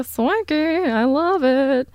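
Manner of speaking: swanky stretched high with a lift at the end; swooning over a swanky speakeasy, swanky sung up high; swanky drawled high. Shown as a demonstration. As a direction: savour swanky, high and drawn out. Repeats swanky, second time marked.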